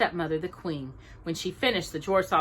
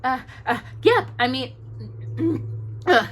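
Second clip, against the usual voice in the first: bashful stammer; 5 semitones higher than usual. A narrator's reading. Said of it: "Bashful answered, face turning pink".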